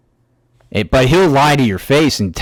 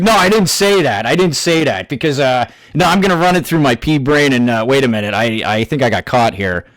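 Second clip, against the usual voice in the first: mocking the rival; 2 semitones higher than usual. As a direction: play him as a dim, flustered liar.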